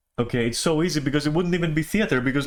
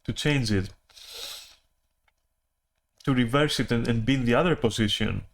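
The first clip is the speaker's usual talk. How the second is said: mouth full. Real, not acted. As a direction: still chewing while talking. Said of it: "I'm gonna start smacking my lips".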